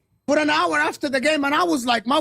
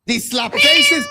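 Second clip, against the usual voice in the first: outraged shout; 11 semitones higher, 5 dB louder, slower than usual. Real, not acted. Outraged accusation.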